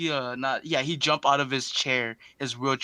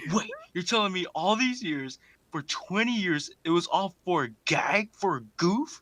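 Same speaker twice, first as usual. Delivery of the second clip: mock disbelief; 7 semitones higher than usual. Harry voiced, disbelief.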